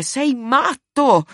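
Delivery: high, slow, frightened yell; shout. A narrator's reading. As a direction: scream it out in terror. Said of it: "Vide Leo urlare". (urlo spaventato, acuto e lento)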